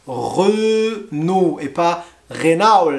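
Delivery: "Renault" right as "rəno", then wrong as "rena". Shown as a demonstration. Teacher contrasting right and wrong "Renault" for learners. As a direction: contrast French "Renault" with a mangled spelling-based one.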